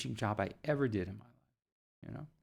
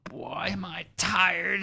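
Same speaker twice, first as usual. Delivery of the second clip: hammed-up weary sigh, whispered then slow; mock tired; 4 semitones higher, slower than usual. Teacher faking tiredness to give the pupil a break, slow mock weariness. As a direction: pretend to be worn out for the child.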